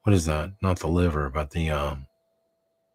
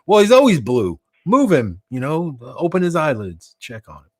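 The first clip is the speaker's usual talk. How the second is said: louder, higher mock exasperated voices; acted back-and-forth; 5 semitones higher, 6 dB louder than usual. Friends arguing over the blue guy, mock exasperated. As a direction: play both sides of a silly argument, raised.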